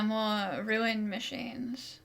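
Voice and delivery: ordinary talk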